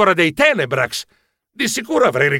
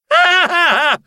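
high, louder staccato yelps; startled cry; 13 semitones higher, 3 dB louder, slower than usual. A narrator's reading. Narrator hit by a bowl of pudding, startled cries. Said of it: "gridai sbarrando gli occhi".

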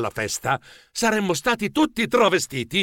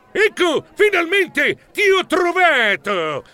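strillo acuto e trascinato; Mel Colossal che strilla; 12 semitones higher, 3 dB louder, slower than usual. Acted. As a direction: strilla lentamente chi ti ha scovato, molto in alto. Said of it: "strillò in quel momento Mel". (strillo acuto di trionfo)

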